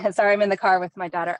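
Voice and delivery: normal talking voice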